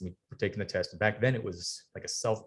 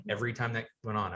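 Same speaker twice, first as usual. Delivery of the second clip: ordinary talk, nothing marked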